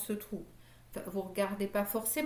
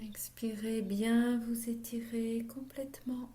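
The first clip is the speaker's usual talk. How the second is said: slow whispered breathing cues; whispered guidance; slower than usual. Acted. Yoga teacher guiding the class's breath, whispered. Guides breathing at session start.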